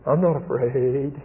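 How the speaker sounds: slow, quavering pretend bravery; comically wobbly, drawn-out denial; mock shaking fear. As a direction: act out trembling while claiming courage. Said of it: "he's shaking all over".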